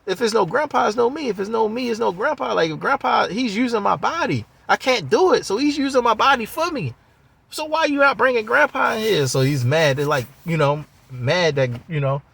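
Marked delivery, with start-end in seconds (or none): 0.1-9.3 pitched far up, insistent arguing; pleading grown man. Retold or imitated. Ray insisting Grandpa must come, high and pleading. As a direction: press the point over and over. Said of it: "we're like".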